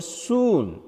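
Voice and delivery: soon with the oo held long; lengthened oo in "soon". Shown as a demonstration. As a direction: draw out the oo of soon. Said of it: "then it is a long vowel".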